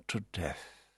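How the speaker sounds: whispered, dragging boredom; a soft whisper of utter boredom. A narrator's reading. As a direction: breathe it out, bored to death. Whispered by narrator.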